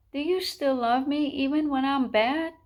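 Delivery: a touch of tearful pleading; crying chick. A narrator's reading. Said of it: "he cried".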